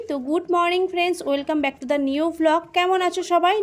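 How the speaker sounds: slow, drawn-out vlog greeting; vlog intro. Real, not acted. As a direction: greet viewers slowly, like an intro. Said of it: "ইন্ট্রোটা দিয়ে নিই". (ভ্লগের শুরুর অভিবাদন)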